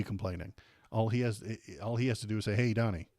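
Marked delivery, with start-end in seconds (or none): none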